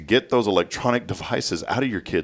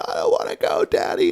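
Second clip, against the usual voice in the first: put-on very high little-girl plea; more serious pleading. Dad imitates daughter nearing the ride, high pleading child voice. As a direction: whimper it like a reluctant child. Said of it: "a little bit more serious".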